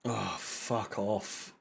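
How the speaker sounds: very low, slow and soft; weary dejection. Real, not acted. Fan giving up on the team, weary quiet curse. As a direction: curse under your breath, deflated.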